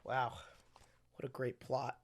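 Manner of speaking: ordinary delivery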